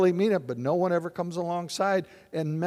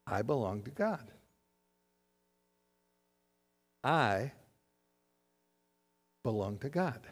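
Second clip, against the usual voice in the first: soft and low, lingering; quiet reflective; 7 semitones lower, 10 dB quieter than usual. Real, not acted. Pastor to congregation, hushed and low. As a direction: let the words sink in softly. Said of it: "Dwell on those words".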